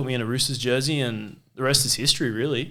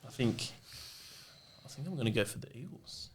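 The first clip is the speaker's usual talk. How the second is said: soft and fading, much quieter; timid kid confession; 4 semitones lower, 15 dB quieter than usual. Retold or imitated. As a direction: confess it to dad meekly. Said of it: "And I'm like".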